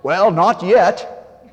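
put-on slow, drawn-out Maine accent. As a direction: drawl it in a Down East Maine accent. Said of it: "he put on his best Maine accent".

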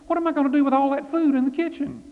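high, fast, fretful outburst; suddenly remembering all the food; jumping up in a fluster; flustered exclamation. A narrator's reading. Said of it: "Martha jumped up and said".

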